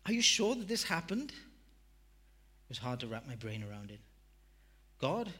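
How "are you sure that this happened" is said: high, loud and rising in disbelief; can't believe what he hears; disbelieving question. Retold or imitated. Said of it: "I asked".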